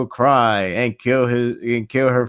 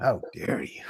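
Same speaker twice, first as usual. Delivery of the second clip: mock whisper; 3 semitones lower, 10 dB quieter than usual. Mock outrage at cohost's cruelty.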